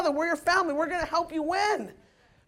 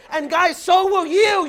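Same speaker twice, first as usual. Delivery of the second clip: rousing shout; 5 semitones higher, 7 dB louder than usual. Real, not acted. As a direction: fire the crowd up, loud and high. Rallying the crowd, preacher-like.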